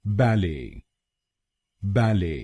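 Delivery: बैले with silent T, first syllable stressed; BAL-ay, falling away on the end of बैले; British बैले, weight on the first syllable; British pronunciation. Shown as a demonstration. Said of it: "British pronunciation इसको बोलेंगे".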